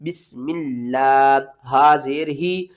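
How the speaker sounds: slow, measured sing-song spelling; spelling out syllables. Shown as a demonstration. Teacher showing how to read it, slow syllable drill. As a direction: spell each letter, then chant the joined word slowly. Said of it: "किस तरह पढ़ेंगे?".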